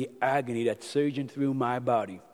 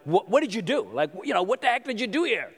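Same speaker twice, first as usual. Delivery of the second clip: retold sharp scolding, higher; forceful scolding; 6 semitones higher than usual. Father scolding his son over the commotion, forceful. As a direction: snap at the son in mock anger. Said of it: "forceful way".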